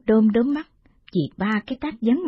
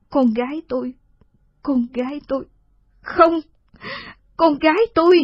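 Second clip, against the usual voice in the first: anguished scream; 8 semitones higher than usual. Acted. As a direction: cry out her daughter in anguish. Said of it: "Thị hét lên".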